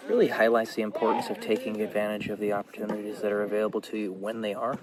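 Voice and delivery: usual speaking voice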